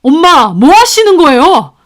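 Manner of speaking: high, loud cry of alarm; shocked protest. Acted. Son shouting at his mother after she throws the chicken. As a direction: stop her right now.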